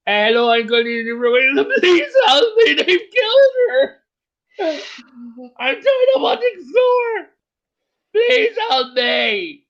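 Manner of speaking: high, loud, slow hammed-up panicked shout; mock panicked plea. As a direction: wail for help in a fake panic. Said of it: "they're like".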